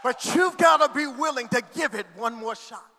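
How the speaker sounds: loud, fervent sermon climax; full-force preaching. Acted. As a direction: preach it at full voice over the music.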